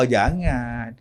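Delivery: usual speaking voice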